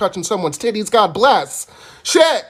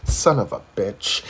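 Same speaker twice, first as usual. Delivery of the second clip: hushed, breathy cursing under the breath; low hushed dread; muttered curse; 7 dB quieter, much slower than usual. Retold or imitated. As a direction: swear quietly to yourself, dreading it. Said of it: "I'm like".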